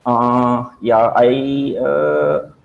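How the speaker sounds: drawn-out uhs between stray words; stalling, searching-for-words filler demo; demonstrating fillers. Shown as a demonstration. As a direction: hesitate with long, stalling filler sounds. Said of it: "Fillers are those words like".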